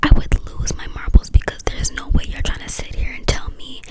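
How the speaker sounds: usual speaking voice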